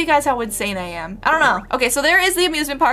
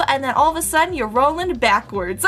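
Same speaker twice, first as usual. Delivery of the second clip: ordinary talk, nothing marked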